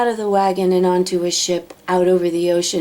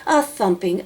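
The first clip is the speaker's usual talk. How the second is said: plain reading voice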